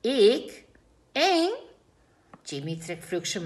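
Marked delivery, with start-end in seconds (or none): 0.0-1.5 high, loud astonished questions; can't believe he's scary; surprised disbelief. A narrator's reading. Wolf surprised at being scary.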